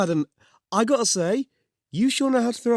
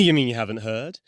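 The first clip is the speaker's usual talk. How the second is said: ordinary talk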